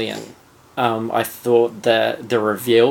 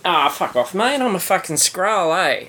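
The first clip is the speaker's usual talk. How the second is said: put-on broad Australian accent, a touch higher and cocky; Australian Skrull; 6 semitones higher than usual. Cohost voices the Australian Skrull, brash Australian accent. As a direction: play the Aussie Skrull, laid-back and rude. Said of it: "a hundred percent Australian accent".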